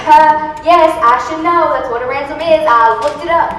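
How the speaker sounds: loud, indignant insisting; loud insistent. Acted. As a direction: raise your voice to insist. Kidnapper insisting on ransom.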